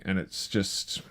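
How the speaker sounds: ordinary talk, nothing marked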